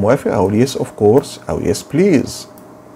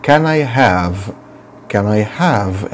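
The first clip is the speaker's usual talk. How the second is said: very slow model phrase; slow teacher drill; much slower than usual. Shown as a demonstration. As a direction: stretch each word for the class. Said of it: "teacher drills phrase".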